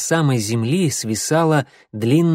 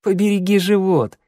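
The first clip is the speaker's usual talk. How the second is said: joking; 7 semitones higher than usual. A narrator's reading. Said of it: "пошутил Огонёк".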